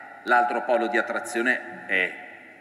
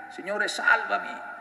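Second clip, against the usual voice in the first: high, pleading call of a sinking man; cry for help; 9 semitones higher than usual. Acted. Peter sinking in the storm, a high desperate cry. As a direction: cry out to the Lord to be saved. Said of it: "lo spingono a gridare".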